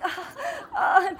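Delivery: high, strained cries of pain; hammed-up wincing gasps; pained groans. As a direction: act out hurting with every step. Remember stitches.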